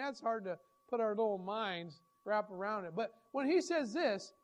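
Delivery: normal talking voice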